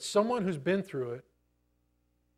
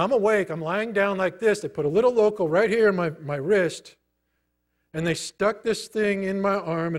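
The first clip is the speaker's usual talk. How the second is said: ordinary talk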